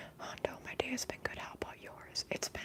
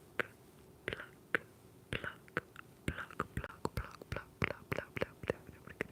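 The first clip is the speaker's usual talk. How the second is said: crisp whispered word, repeated rhythmically; repeated trigger whisper; much slower than usual. Shown as a demonstration. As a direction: whisper it over and over as an ASMR trigger.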